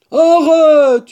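high, loud cry to stop; exasperated shout; yelling. Acted. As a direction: yell it to cut the talk off. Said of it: "hurla Blaireau".

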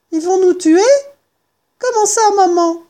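put-on high, loud child fox voice; frightened fox cub. Fox cub asks mother about the diggers, frightened child voice. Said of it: "écarquillés de terreur".

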